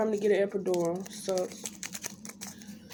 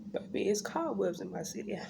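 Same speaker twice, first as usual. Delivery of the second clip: whispered aside; 10 dB quieter than usual. Retold or imitated. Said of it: "I'm like".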